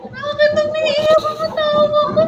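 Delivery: high, sustained singsong wail; mock wail. Streamer asking viewers why their laugh is crying, mock wailing. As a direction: wail it high, stretching the last vowels.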